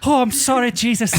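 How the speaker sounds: badly faked Irish lilt, sorry to Jesus; mock Irish apology. Co-host joins the bit in a mock Irish accent. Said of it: "We're both terrible at accents".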